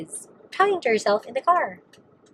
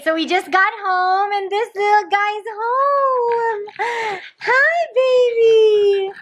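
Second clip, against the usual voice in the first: high, slow cooing to a puppy; 11 semitones higher, 4 dB louder, slower than usual. Real, not acted. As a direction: gush at the dog. Dog greeting, baby talk.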